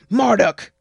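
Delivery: loud, high angry shout of his name. Acted. Mother shouting at her son in anger. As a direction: bellow at him. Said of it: "I shouted".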